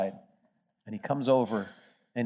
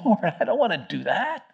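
high, pleading objection; a nervous disciple protesting to God; worried protest; 9 semitones higher, 3 dB louder than usual. Acted. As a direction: plead with the Lord, alarmed. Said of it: "And he's saying".

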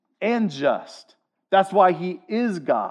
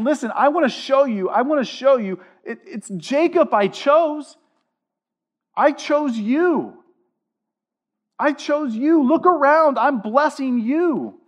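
God speaking; 7 semitones higher than usual. Acted. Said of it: "And God's saying".

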